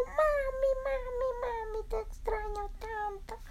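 tiny, very high, tearful squeak; Berilio. Acted. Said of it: "la abrazó y le dijo".

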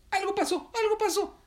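very high, loud squawk of alarm; alarmed cry. Acted. Heron feels something happened, crying out alarmed.

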